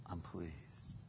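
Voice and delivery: very soft, low divine reply; God's quiet reassurance. Acted. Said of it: "But he goes".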